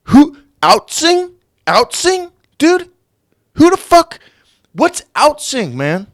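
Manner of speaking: high, loud incredulous squeal; mock disbelief. Real, not acted. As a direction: ridicule the word in exaggerated shock. Mocks the strange word.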